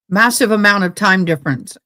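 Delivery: ordinary talk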